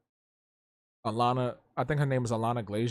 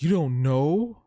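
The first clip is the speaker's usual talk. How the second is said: loud, higher-pitched mock disbelief, swooping up at the end; Knives Chow; 4 semitones higher, 9 dB louder, much slower than usual. Coworkers shocked at his ignorance, mock fangirl disbelief. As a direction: imitate an appalled fangirl, loudly. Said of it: "They were acting like Knives Chow".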